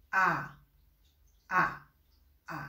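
ا said as a sound between آ and ا, repeated with pauses; isolated vowel drill; German A sound demo. Shown as a demonstration. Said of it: "نه آ هست و نه ا".

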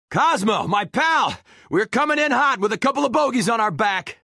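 high, hurried call-out; urgent hail. Acted. Pilot warns Cosmo of bogeys behind, urgent hail. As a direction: call ahead with trouble on your tail. Calling ahead while chased.

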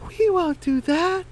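very high, lazy chicken voice; very high voice refusing the work; high Poppy Seed voice. Acted. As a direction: pitch way up for the idle hen.

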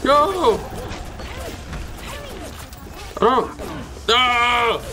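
high startled yells, one after another; panicked yells. Real, not acted. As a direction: yelp as you get hit. Attacked in game, screaming.

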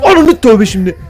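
panicked swear; startled shriek. Real, not acted. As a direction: yelp at the scare. Jumpscare reaction.

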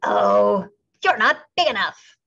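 scornful ladybug. A narrator's reading. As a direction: wave them off with contempt. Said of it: "said the grouchy ladybug".

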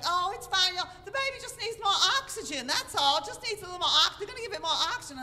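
very high, loud, frantic Southern friend; nervous Southern accent. Retold or imitated. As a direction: nervously babble reassurance in exaggerated Southern accent. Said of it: "talking in that very nervous Southern accent".

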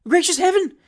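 high, loud cry of alarm; startled lady. A narrator's reading. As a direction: exclaim in a high-pitched fright. Startled lady hears the loud ahem.

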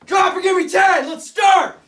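shouted high and loud; barking gym coach. Acted. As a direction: yell orders at recruits. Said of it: "I'll be the sergeant".

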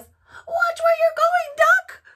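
very high, loud, slow squawk; cross chicken. A narrator's reading. Chicken scolding the careless duck, high squawk. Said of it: "what she thought was".

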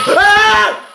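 sudden high-pitched scream of fright; startled scream. Real, not acted. Gamer jump-scared by Herobrine, loud high scream. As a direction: shriek as the monster jumps out.

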